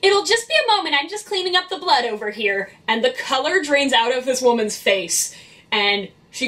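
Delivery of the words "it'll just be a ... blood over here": high, sing-song customer service chirp. Acted. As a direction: put on a polite, high-pitched customer service voice. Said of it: "my customer service voice go".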